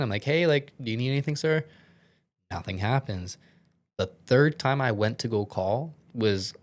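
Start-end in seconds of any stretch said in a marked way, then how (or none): 0.2-1.6 calling out again. Retold or imitated. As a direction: call out, fast, louder and higher. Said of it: "Call again".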